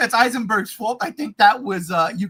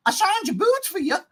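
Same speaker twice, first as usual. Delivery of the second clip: higher mock mother's voice; mock Ma Kent; 9 semitones higher than usual. Host mocking the line from Ma Kent. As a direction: quote her sweetly mocking. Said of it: "Ma Kent".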